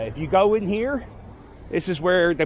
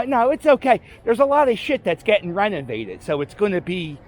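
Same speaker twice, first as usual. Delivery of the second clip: ordinary talk, nothing marked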